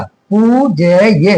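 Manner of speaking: पूज्य slowly, stretched as "pooo-ja-ya"; long-vowel demo. Shown as a demonstration. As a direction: draw out the long oo at the start of पूज्य. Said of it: "पू बड़ा आएगा".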